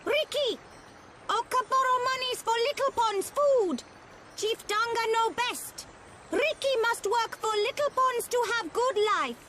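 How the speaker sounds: very high, nagging little voice; high-pitched, insistent creature; scolding creature wife. Acted. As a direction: scold your husband into working. Wife scolds Ricky about money.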